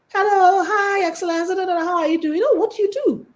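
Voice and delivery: high, bright-voiced eager schmoozing hello; eager networking greeter. Rich guests greeting you at a networking dinner, eager and high. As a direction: gush the greeting like a keen networker.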